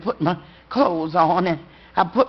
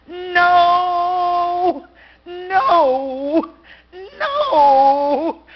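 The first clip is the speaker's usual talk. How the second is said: high, loud grieving cries; anguished wail; 9 semitones higher, 6 dB louder, much slower than usual. Acted. A father crying out in grief at his wife's bedside, high and loud. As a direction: cry out in grief.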